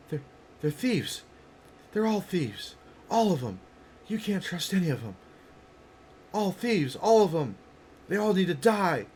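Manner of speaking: high, agitated accusing voice; distrustful accuser. Acted. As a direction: rant in alarm about untrustworthy strangers.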